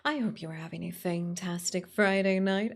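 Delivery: ordinary delivery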